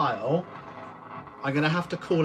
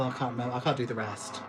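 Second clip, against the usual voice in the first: normal talking voice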